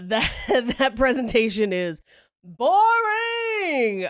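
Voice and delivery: drawn-out, high sing-song "boring"; mock exasperated boring. Real, not acted. Host panning a dull convention presentation, mock exasperated. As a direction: stretch it out high to hammer the tedium.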